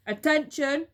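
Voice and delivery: loud barked command; fearsome monkey trainer. A narrator's reading. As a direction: bark it at the caged monkeys. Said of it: "barked in his fearsome monkey trainer voice".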